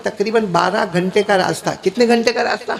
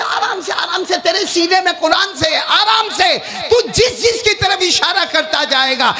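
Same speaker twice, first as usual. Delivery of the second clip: laughing calm-down; 9 semitones higher than usual. Acted. Allah calms the eager hafiz.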